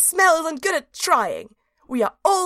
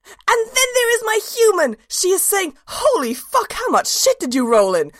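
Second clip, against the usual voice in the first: much higher and louder, bursting with glee; overjoyed; 10 semitones higher, 5 dB louder than usual. Acted. Dog narrator sees its human again, overjoyed and loud. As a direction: let the joy spill over the reunion.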